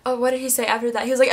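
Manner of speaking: ordinary talk, nothing marked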